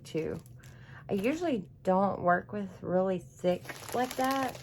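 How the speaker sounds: ordinary delivery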